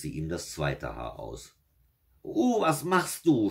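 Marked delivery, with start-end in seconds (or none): 2.2-3.5 high, loud, drawn-out devil's outcry; angry cry. A narrator's reading. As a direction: howl in outrage at being woken, stretched out. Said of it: "schrie der Teufel zornig".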